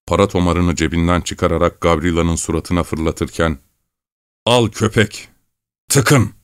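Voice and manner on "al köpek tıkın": higher, scornful bark; contemptuous shout. A narrator's reading. Thief throws the cash in the boy's face, scornful shout. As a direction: fling the words like the money. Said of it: "diye bağırdı".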